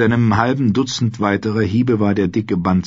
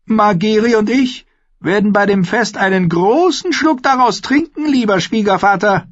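high-pitched royal proclamation; high, carrying boy-king voice; boastful boy king; 4 dB louder than usual. A narrator's reading. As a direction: play a young king showing off, pitched very high.

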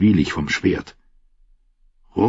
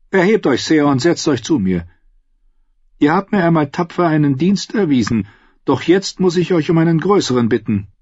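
high, commanding noblewoman; lightly voiced scheming queen; 9 semitones higher than usual. A narrator's reading.

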